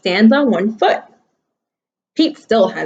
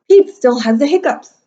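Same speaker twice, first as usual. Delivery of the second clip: ordinary delivery, nothing marked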